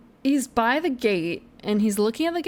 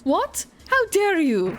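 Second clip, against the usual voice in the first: indignant outburst; 8 semitones higher, slower than usual. Acted. Indignant judge in game dialogue.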